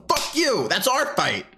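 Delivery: high, punchy mock yell. Americans telling outsiders to butt out, mock yell. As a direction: snap back like a protective brother. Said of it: "we go".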